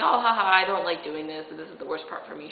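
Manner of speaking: ordinary talk, nothing marked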